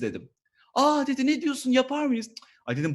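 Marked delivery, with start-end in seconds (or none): 0.7-2.3 high, louder gushing surprise; mock surprised boss. Comedian mimics manager's delighted surprise. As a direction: gasp delighted at the offer.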